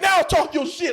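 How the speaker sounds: high, forceful dare; triumphant taunt. Retold or imitated. Older actor pinning the younger one down, forceful taunting. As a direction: throw it down at him, high and shouted. Pinning him on floor, daring him.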